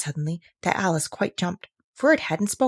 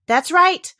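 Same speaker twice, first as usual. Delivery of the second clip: very high, louder cry of approval; triumphant approval; 12 semitones higher, 8 dB louder than usual. Acted. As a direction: crow it in triumph. Tiger lily approves loudly.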